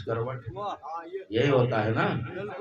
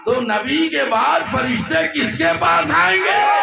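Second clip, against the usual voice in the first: very high, loud rhetorical question; "آئیں گے" flung out at the top of the voice; shouted question; 9 dB louder than usual. Acted. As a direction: shout "تو نبی کے بعد فرشتے کس کے پاس آئیں گے؟" to the crowd. Rhetorical question shouted, then repeated softly.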